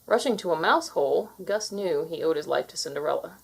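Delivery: ordinary delivery, nothing marked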